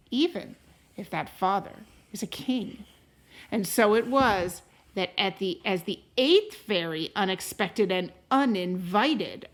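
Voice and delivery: unhurried read-aloud voice, rising on the opening lines; storybook narration. A narrator's reading. Parent reading a fairy tale to a child, gentle storytelling. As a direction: tell it like a bedtime story to a child. Reads a picture book aloud.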